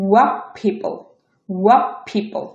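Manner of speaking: what said "wap" before people; assimilated T. Shown as a demonstration. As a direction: end what on p, merging into people. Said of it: "এটা উচ্চারণ হবে".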